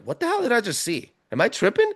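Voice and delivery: high, startled asking himself; reliving the shock of what he just saw; retold disbelief at a strange sight; stunned disbelief. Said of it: "And I'm like".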